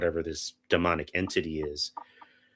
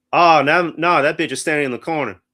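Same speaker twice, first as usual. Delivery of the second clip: loud, higher-pitched imitation of a moviegoer; audience member's voice; 8 semitones higher, 10 dB louder than usual. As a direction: call it out like a heckler at the screen.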